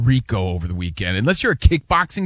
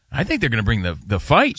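ordinary talk, nothing marked

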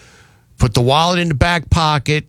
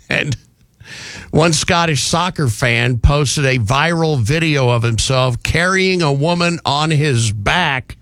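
ordinary talk, nothing marked

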